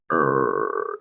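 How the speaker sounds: low, soft American "er" noise; low-energy American "er" noise; low lazy "er". Shown as a demonstration. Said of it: "that kind of more ... noise".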